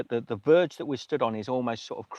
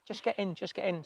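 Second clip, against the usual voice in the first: high, softer imitation of her urging; retold insistent officer; 9 semitones higher, 7 dB quieter than usual. Police officer urging walkers into her car, high soft imitation. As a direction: lift the pitch and lighten it to mimic her.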